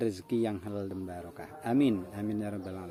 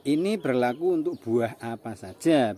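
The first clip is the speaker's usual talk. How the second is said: usual speaking voice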